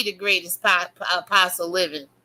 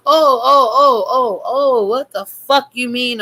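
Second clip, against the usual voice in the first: mock wailing; 3 semitones higher, 4 dB louder than usual. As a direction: wail it out in ridicule. Mocking wail at followers.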